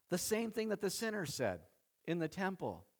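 ordinary delivery

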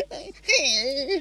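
high strained mock laugh-cry; half-crying, half-laughing. Doing Christopher Walken in Russian roulette, half crying, half laughing. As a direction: laugh and sob at once, high. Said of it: "kinda half crying, half laughing".

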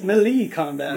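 melee as "muh-LEE", high and loud; playful mispronunciation. Pretend. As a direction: lean hard on the LEE of melee. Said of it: "it's not pronounced that way".

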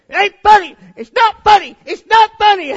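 put-on high, very loud child's protest; angry yell. Boy shouting at his laughing dad, furious yell. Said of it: "it made me so mad".

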